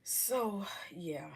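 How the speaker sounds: breathy whisper, under her breath; whispered aside. Real, not acted. To her viewers, a whispered resigned aside. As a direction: let it slip out unvoiced.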